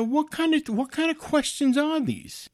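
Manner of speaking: retold outraged questioning; indignant disbelief. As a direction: object in high-pitched exasperation.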